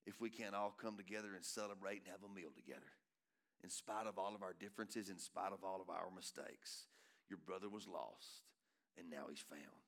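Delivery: low, hushed, earnest appeal; quiet fatherly plea, fading at the end; tender father. Acted. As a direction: drop soft and low, letting it sink in. Father's plea to older son softens.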